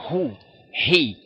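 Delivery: light aspirated h opening "hu" and "he"; Hebrew he sound. Shown as a demonstration. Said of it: "Pues suena así".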